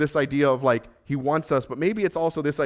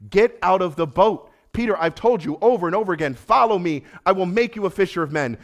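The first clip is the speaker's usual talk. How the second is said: urgent call; 5 semitones higher, 4 dB louder than usual. Acted. Jesus urging Peter.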